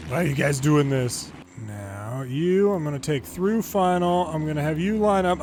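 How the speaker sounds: ordinary talk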